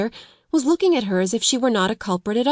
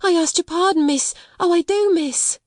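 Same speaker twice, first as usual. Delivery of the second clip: high, desperate apology; pleading frightened; 10 semitones higher than usual. A narrator's reading. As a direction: beg forgiveness in a panic. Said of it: "breathless sob".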